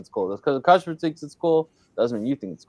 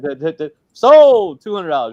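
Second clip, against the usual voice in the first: fast rising auction call, sold shouted loud; auctioneer; 4 semitones higher, 10 dB louder than usual. Retold or imitated. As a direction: rattle off bids then bang out sold. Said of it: "The auctioneer voice".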